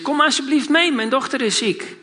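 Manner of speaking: retold plea, starting high and dropping off; urgent plea. Father asks Jesus to come to his sick daughter, mildly pleading.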